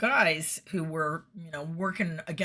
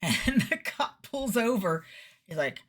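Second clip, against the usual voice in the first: normal talking voice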